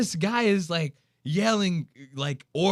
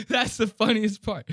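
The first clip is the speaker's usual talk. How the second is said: usual speaking voice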